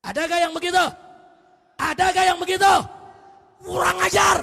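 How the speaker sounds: high, loud preaching shout; khotbah berapi-api. Real, not acted. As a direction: thunder the challenge at the congregation. Preaches at full force. (khotbah lantang dengan nada tinggi)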